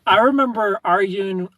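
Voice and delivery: normal talking voice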